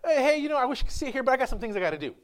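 high, airy brush-off; mock-friendly high voice easing down; polite excuse. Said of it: "It's just like".

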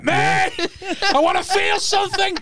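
mock desperate shout. Joking with friends as a reckless gambler begging for a card. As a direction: wail it like a gambler chasing a thrill.

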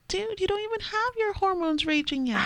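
high, incredulous mock scolding; exasperated mom to toddler. Mom mocking her toddler's tantrums, high and incredulous. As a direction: raise the pitch in disbelief at the kid. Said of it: "I'm like".

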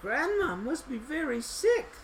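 put-on high child voice; little girl's thought. A narrator's reading. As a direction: think aloud as a little girl.

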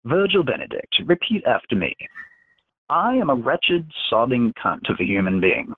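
menacing telepath dictating words to his victim; Dax's commanding voice. Acted.

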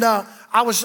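usual speaking voice

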